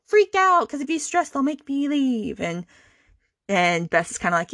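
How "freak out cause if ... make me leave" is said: high-pitched imitated pleading; mock pleading. Imitating the sister begging not to be sent away, mock pleading.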